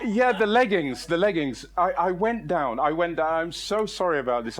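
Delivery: normal talking voice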